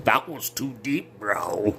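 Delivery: hammed-up throat-cut victim voice; choking victim. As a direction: play the wounded man holding his neck. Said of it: "he's, like, holding his neck like".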